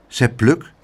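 ordinary delivery, nothing marked

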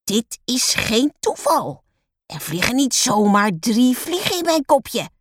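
high, accusing teacher; angry. A narrator's reading. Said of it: "Boos speurt ze de klas rond".

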